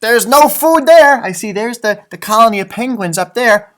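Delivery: ordinary delivery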